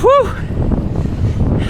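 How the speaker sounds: a long unvoiced high exhale, puffing from the climb; breathless whew. Real, not acted. Cyclist riding uphill, winded, blowing out a long breathless whew. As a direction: blow out hard, winded on the bike. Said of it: "you can probably tell I'm out of breath already".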